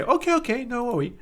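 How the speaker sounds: a beginner brushing off the problem, light and high; carefree beginner. Retold or imitated. Beginner reassuring himself after a tutorial, mock carefree. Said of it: "And they say".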